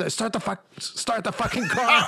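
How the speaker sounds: high mock-frantic demand; retold panic. Retold panicking passengers in back seat.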